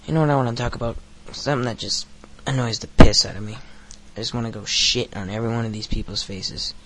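usual speaking voice

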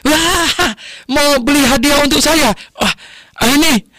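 very high-pitched, hammed-up astonishment; astonished grandfather. Grandfather learning the gift is for him, hammed-up astonishment. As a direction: exclaim high and loud, as if overwhelmed by surprise. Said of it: "Kakeknya dengar itu kaget".

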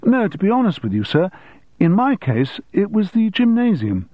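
earnest schoolboy honesty, starting high and settling; candid schoolboy. Acted. Keith corrects the headmaster.

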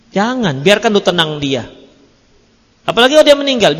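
usual speaking voice

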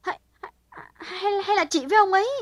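stammered, rising into a loud high question; alarmed stammer. A narrator's reading. Hảo horrified her sister and Đảm are involved, alarmed stammer. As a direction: panic as the suspicion hits. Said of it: "trợn mắt hốt hoảng".

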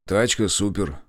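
plain reading voice

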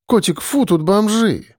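lightly voiced girl, high and squeamish. A narrator's reading. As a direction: wrinkle your nose at the bums. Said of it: "брезгливо поводя плечом".

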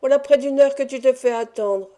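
put-on old nurse scolding the late girl; scolding yelp. A narrator's reading. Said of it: "glapit la vieille Nan".

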